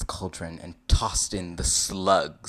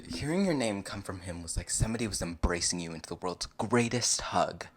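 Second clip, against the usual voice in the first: plain reading voice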